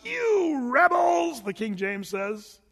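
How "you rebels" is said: drawn-out high yell; mock yell. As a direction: yell it slowly at the rebels. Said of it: "Moses yells out".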